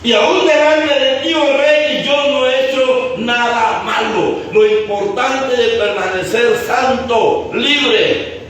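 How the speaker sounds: high, slow, sustained proclamation; preaching declamation. Real, not acted. Daniel answers the king from the pit, proclaimed by the preacher. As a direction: proclaim each phrase, drawing out the endings.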